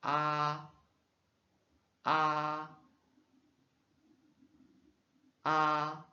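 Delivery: "A" very open and loud, held; open mandarin a. Shown as a demonstration. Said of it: "plus bien ouvert".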